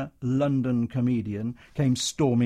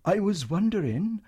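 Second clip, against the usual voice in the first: gentle Welsh lilt; 8 semitones higher than usual. Acted. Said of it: "the Welsh lilt, the gentleness".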